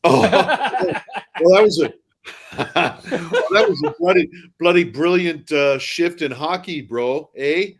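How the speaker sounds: high, loud mash of British and Canadian; British-Canadian mixed accent. Shown as a demonstration. Co-host's challenge to mix UK and Toronto, hammed-up accent. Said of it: "try mixing U K, but fly through Toronto".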